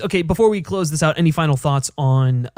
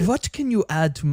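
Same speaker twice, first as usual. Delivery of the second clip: imitating a German accent, lightly done; 4 dB quieter than usual. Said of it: "in his German accent".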